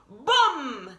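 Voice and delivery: ordinary delivery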